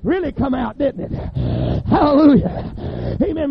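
ordinary talk, nothing marked

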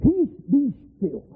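high, slow command to the storm; calm the sea with slow authority; commanding Jesus. Acted. Jesus rebuking the wind, slow high command. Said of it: "He raises up and said".

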